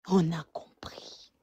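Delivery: soft slow whisper. Real, not acted. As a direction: breathe it out, no voice. Repeated, second take whispered.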